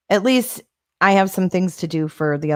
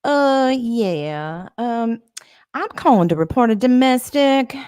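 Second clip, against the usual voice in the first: higher-pitched Southern US caller; Southern accent; 5 semitones higher than usual. Shown as a demonstration. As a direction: put on a Southern accent, reporting a domestic. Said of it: "I actually do a really good Southern accent".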